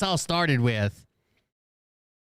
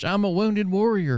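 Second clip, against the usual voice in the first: higher, slow, drawn-out mocking whine; mock complaining; 9 semitones higher than usual. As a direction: mimic a complainer, stretched out and higher. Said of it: "his complaining the whole time".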